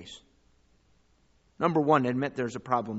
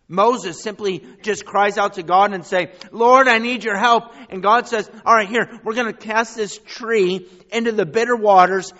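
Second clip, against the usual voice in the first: much higher and louder, fervent preacher; impassioned preaching; 10 semitones higher, 12 dB louder than usual. Acted. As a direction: cry it out to the crowd. Retelling Moses crying out.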